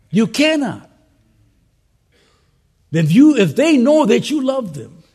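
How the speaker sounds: loud, rising pulpit preaching; preaching at full force. Acted. Preacher urging pastors to love their people, at full force. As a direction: drive it home to the pastors.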